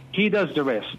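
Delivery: normal talking voice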